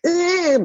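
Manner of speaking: high-pitched "ugh" squeal; imitated worm noise. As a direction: imitate a squirmy little creature with a high groan. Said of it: "this little disgusting worm thing".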